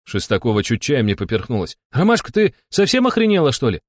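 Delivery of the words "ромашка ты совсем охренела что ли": indignant. A narrator's reading. Outraged at a silly guess.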